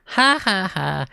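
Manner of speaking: gleeful ha ha ha laugh; mocking laughter. A narrator's reading. Girl laughing at her brother's miss, mocking laugh. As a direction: laugh out at the missed shot. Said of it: "Ashley burst out laughing".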